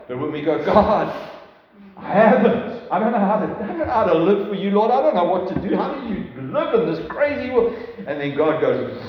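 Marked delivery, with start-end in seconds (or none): 0.6-7.7 desperate pleading. Acted. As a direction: beg God like you are lost and overwhelmed. Pleading prayer quoted in sermon.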